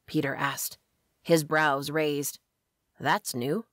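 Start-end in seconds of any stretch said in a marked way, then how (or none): 3.0-3.6 lightly deepened English man's voice; deep English accent. A narrator's reading. Hunter questions the witch's familiar, light deep English accent. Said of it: "He had a deep English accent".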